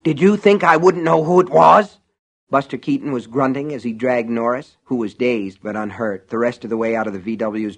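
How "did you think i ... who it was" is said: furious yelling accusation; angry shout. Acted. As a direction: shout it in fury. Buster yelling at Norris.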